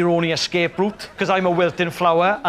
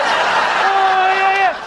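high, louder delighted squeal; squealing girl; 13 semitones higher, 4 dB louder, much slower than usual. Retold or imitated. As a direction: squeal with glee. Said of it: "She's like".

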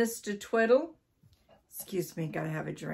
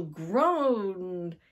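stretched word, acting the groan; drawn-out groan. A narrator's reading. Narrator tells of the frightened wife, long groaned word.

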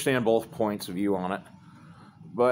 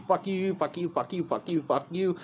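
higher, lightly delivered run of repeated curses; mock cursing dad; 8 semitones higher, 3 dB quieter than usual. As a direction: imitate dad dismissing each person in turn. Dad resumes telling everyone off.

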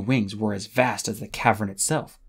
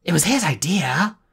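higher, sharp accusing snarl; 6 semitones higher, 3 dB louder than usual. A narrator's reading. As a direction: throw the blame with teeth bared. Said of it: "Kestrel snarled".

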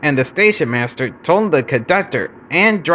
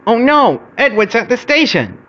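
dismayed cry; 8 semitones higher than usual. A narrator's reading. Bertie sees Edward at the station, dismayed.

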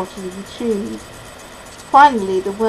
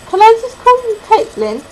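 put-on high, louder small-boy request; little boy's voice; 12 semitones higher, 6 dB louder, faster than usual. A narrator's reading. Boy asks to call her Caitlin, little boy's voice.

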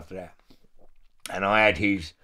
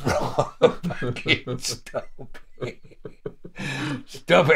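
laughing; 2 semitones higher, much slower than usual. Real, not acted. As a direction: beg through laughter. Laughing, retelling fear.

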